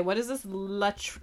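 ordinary talk

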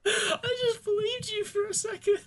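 high-pitched, helpless laughing speech. Real, not acted. Host to co-host after falling for a joke, laughing. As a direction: squeal it through laughter.

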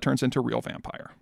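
ordinary talk

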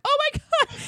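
high exasperated oh my God; overwhelmed by the mess; exasperated cry. Retold or imitated. Wife running outside in pajamas, high exasperated cry.

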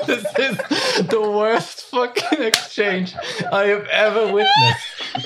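high, slowed words through laughter; cry-laughing disbelief. Real, not acted. Player cry-laughing at the accent exchange. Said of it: "laughing at the accents".

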